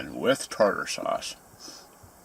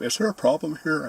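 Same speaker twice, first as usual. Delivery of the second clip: higher, louder manager voice; mock manager stepping in, pitched up; imitating the manager; 9 semitones higher, 4 dB louder than usual. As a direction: play the officious manager.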